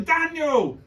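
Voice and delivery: high, loud call across a station; insistent high-pitched shout for someone. Retold or imitated. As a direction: yell the name, pitch falling away at the end. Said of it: "he's just stood there shouting".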